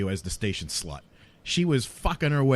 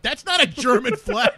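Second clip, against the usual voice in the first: very high, laughing disbelief; mock-outraged squeal through laughter; retold incredulous protest; 4 dB louder than usual. Retold outraged reply to caller.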